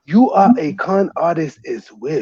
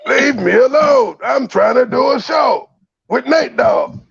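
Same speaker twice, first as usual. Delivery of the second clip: mock outraged shout; 7 semitones higher than usual. Host yelling at Joy for feeding her to the wolves, mock outrage. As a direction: shout at Joy like she betrayed you.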